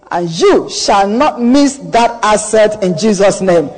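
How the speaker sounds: usual speaking voice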